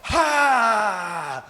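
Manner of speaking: sudden sharp high "Ha!" in the face; imitated shout. Wrestler shouting in opponent's face, sharp mock yell. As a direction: bark it right at him like a wrestler. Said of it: "he just went like".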